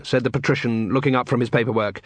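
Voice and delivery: ordinary delivery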